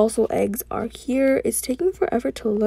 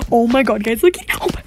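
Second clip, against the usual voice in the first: excited outburst; 5 dB louder, faster than usual. Real, not acted. Gamer showing viewers the new event, thrilled outburst. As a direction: burst out, voice jumping up.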